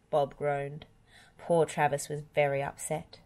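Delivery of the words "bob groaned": ordinary delivery, nothing marked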